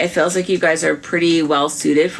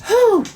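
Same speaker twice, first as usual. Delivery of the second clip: relieved whew; 4 dB louder than usual. Pretend. Relief after a cry.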